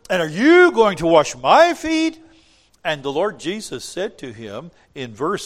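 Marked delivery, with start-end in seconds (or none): 0.1-2.2 much higher, louder, outraged question; Peter in disbelief. Retold or imitated. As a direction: play Peter, shocked and refusing. Peter's protest voiced.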